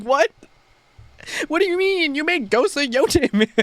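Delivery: high, incredulous what do you mean; can't believe the praise; stunned disbelief. Retold or imitated. Telling the game director he made Ghost of Yotei, incredulous.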